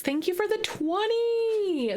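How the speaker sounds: high, slow, overwhelmed thank-you; gushing thanks. Real, not acted. Streamer thanks a viewer for twenty subs, high and slow. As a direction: draw the thanks out in amazement.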